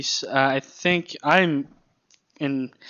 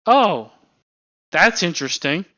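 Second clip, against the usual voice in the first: higher, louder "oh" of staged interest; mock interested reaction; 5 semitones higher, 5 dB louder than usual. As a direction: mimic a hammy cartoon reply. Mocking a kids' show character's reply.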